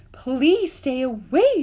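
high, loud, slow robot plea; pleading robot. A narrator's reading. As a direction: stretch it out, begging them off. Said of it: "said the robot".

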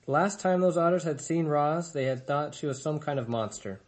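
ordinary delivery, nothing marked

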